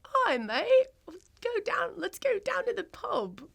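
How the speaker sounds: high-pitched put-on London accent. Shown as a demonstration. American guest shows host their best London accent. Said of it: "your best London accent".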